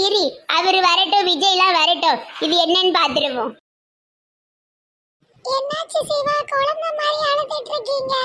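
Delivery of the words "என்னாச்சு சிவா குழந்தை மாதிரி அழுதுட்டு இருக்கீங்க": put-on high, soft young woman's voice; soft, high wife comforting her husband.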